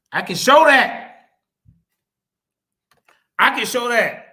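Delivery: loud, forceful insistence; emphatic challenge. Real, not acted. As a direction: declare it loudly as a challenge. Boasting of proof against opponent.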